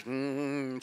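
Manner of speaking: soft sustained hum; imitated bee hum. Bees signalling that they got it, soft imitated hum. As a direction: drone along like the swarm. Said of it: "as soon as everybody's".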